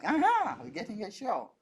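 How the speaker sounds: high gleeful Aha, then dropping; mock eager crowd. Onlookers at the border cheering the dancer on, mock glee. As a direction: cheer like a crowd about to get a free show. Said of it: "everyone is like".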